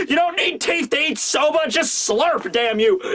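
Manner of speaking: high, exasperated mock shout; furious yelling. Sanji forcing soba on the beaten guy, high angry yell. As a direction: berate the victim at high pitch. Sanji yells back at victim.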